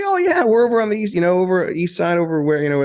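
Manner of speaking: high, lively mock dad voice; imitating the dad. Dad greeting a fellow Hoosier, in a high mock voice. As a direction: pitch it way up like the excited father. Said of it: "He's like".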